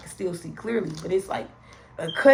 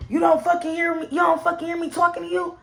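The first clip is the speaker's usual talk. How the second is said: angry yell; 9 semitones higher, 3 dB louder than usual. Retold or imitated. Boyfriend angrily confronting her.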